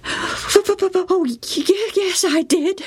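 put-on high, stammering, near-tearful boy; upset boy stammering. A narrator's reading. Joe telling his mother how the boys mocked him, high and near tears. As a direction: choke out the hurtful names.

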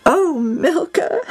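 high, drawn-out moan into sobs; sobbing. A narrator's reading. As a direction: moan the name slowly, then sob. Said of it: "Evie sobbed".

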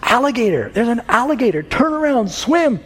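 high, panicked warning call across the yard; mother screaming to her swimming son, frantic high yell. Retold or imitated. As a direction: shout it to the boy in the water, desperate. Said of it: "and yelled".

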